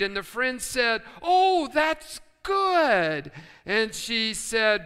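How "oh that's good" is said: drawn-out approval. Acted. Friend reacts in joke.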